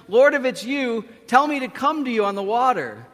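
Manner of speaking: high, loud, eager plea; bold, passionate request. Acted. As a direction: ask boldly, raising your voice. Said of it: "that boldness, that passion that he had".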